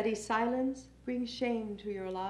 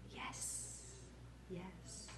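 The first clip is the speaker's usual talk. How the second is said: drawn-out, barely voiced whisper; whispered refrain; 6 semitones lower, 25 dB quieter than usual. Poet answering the name-callers, whispered. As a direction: breathe the words out very softly, spaced apart.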